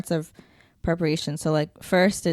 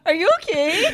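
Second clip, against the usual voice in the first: laughing; 12 semitones higher, 5 dB louder than usual. Real, not acted. Laughing at cousin's slip.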